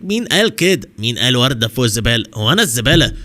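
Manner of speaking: ordinary delivery, nothing marked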